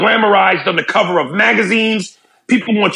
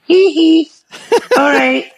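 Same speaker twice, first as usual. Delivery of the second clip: [name] impression; 9 semitones higher than usual. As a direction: giggle it like [name]'s stage persona. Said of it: "he was nothing like".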